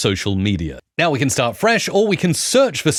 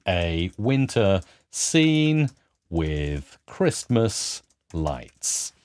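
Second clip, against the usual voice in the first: very slow, low, spaced-out words; typing along; 5 semitones lower, 6 dB quieter, much slower than usual. Real, not acted. Presenter typing an image prompt as he talks, very slowly. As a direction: dictate it slowly, word by word.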